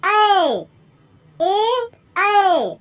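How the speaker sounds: "ए" short and "ऐ" loud and long; vowel contrast. Shown as a demonstration. Teacher contrasting "ए" and "ऐ" for students, loud. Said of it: "अब देखिए".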